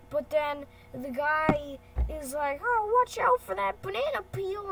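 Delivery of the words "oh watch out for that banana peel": high, loud cartoon warning; mock alarmed warning. Stick guy warning woman of a banana peel, mock alarm. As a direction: call out a hammed-up warning. Guy warns her in story.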